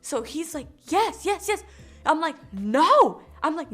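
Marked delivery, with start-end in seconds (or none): 0.9-1.6 high, eager imitation of a pest; mock insistence, louder and higher; insistent man. 2.5-3.2 loud, drawn-out refusal; mock-exasperated loud rebuff; retold emphatic refusal.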